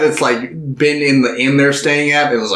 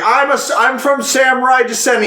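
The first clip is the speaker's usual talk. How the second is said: much higher proud boast; boastful drunk; 10 semitones higher than usual. Retold or imitated. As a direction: puff up like a drunk braggart. Imitating Kikuchiyo boasting.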